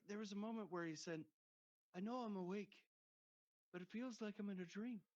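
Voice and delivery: high put-on Irish lilt, nervous cover; lightly hammed nervous cover-up; high bluffing voice on the phone to the boss; mock Ken on phone.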